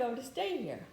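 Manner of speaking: words stretched through a real yawn; yawning while talking. Real, not acted. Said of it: "So while I'm yawning".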